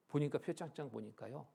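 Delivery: ordinary delivery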